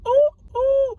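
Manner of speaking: very high, loud "ooh, ooh"; two piercing little whoops; high whooping. Real, not acted. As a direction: let out two squealed oohs. Excited reaction to chain moment.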